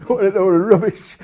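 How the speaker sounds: loud dismissive jeer with a laugh; scornful audience. Retold or imitated. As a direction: scoff at it like a sceptical crowd. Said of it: "they're gonna say".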